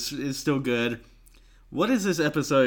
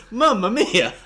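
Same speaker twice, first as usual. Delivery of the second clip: "Mamma mia!" yelled much higher and louder, falling at the end; exclaimed Mamma mia; 12 semitones higher, 10 dB louder than usual. Retold or imitated. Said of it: "Midway through the episode".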